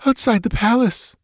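put-on high, timid girl's whimper. A narrator's reading. Frightened girl answering her interrogator, whimpering. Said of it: "she whimpered".

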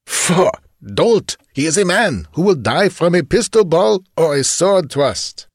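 high-pitched, contemptuous outburst; scornful chief. A narrator's reading. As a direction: scoff at a superstitious underling. Bandit chief scorns henchman.

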